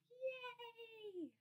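high, soft, drawn-out cheer; excited cheer. Shown as a demonstration. Teacher showing her class excited, high little cheer. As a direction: cheer high as if thrilled, without shouting. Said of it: "Can you show me excited?".

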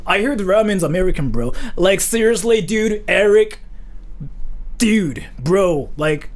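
American bro voice. Acted. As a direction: play the clueless American bro. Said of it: "that's what I think Americans sound like".

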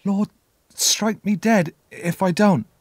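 put-on high, slow, halting child's voice; small high boy's voice, haltingly obeying; frightened boy repeating. A narrator's reading. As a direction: repeat the oath timidly, pitched well up. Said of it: "I repeated".

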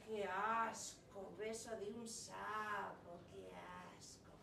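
slow, drawn-out disgust; disgusted princes. Acted. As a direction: recoil at the idea.

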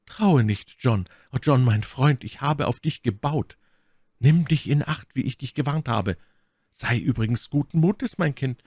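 put-on a lord cautioning his young officer; warning lord. A narrator's reading. Lord Winter voiced by narrator. (Lord Winter warnt John eindringlich, höher)

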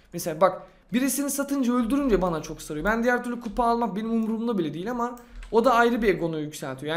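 ordinary talk, nothing marked

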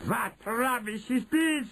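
high, slow, scornful witch cry; imperious shout. Acted. Grand High Witch scorns the report, high shout. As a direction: demand it high and stretched. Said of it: "shouted the Grand High Witch".

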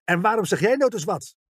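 sharp, high-pitched snap; snauwend. A narrator's reading. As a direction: snap at your brother in irritation. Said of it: "snauwde ze tegen haar broer". (bits uitvallen, hoog van toon)

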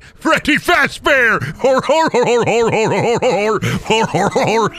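Freddy Fazbear. Acted. Announcing a favorite Muppet, in a goofy character voice. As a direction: belt out a mock Muppet cheer and chortle. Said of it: "who my favorite Muppet is".

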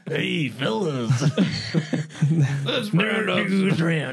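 slow, loud cowboy drawl with laughter. Pretend. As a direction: laugh through a hammy cowboy call. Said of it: "Hey, that's my cowboy voice".

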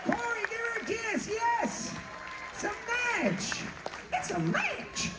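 very high, thrilled yelling; excited shout. Real, not acted. Game show host cheering a winning couple, screaming high. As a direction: scream it like a host who just got a match.

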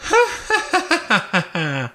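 high, shrill burst of hysterical laughter. Acted. Vera breaks into hysterical laughter after her fright, high laugh. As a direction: laugh out the terror, high and unhinged. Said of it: "Elle éclata d'un rire hystérique".

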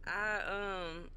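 usual speaking voice

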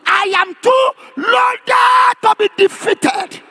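high, slow declaration with LOADED held long; drawn-out proclamation. Shown as a demonstration. Preacher has congregation announce it to five people, drawn-out proclamation. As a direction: stretch it out like a rallying cry.

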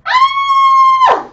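a big staged scream of fright; hammed-up scream. Shown as a demonstration. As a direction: shriek in mock terror at the monster. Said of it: "y grita".

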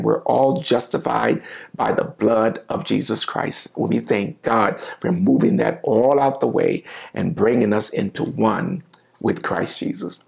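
ordinary talk

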